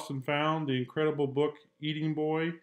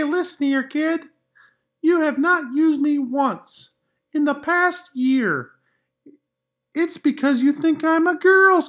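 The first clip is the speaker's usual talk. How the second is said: much higher, louder, prim crayon; high, fussy crayon demanding attention; sassy Pink Crayon; 12 semitones higher, 11 dB louder than usual. Acted. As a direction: scold like a miffed pink crayon. Pink Crayon letter read in character.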